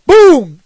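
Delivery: a loud, punchy boom sound effect; mock explosion. Gamer blowing up an enemy, loud mock boom. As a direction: blast out a cartoon boom. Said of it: "And I was like".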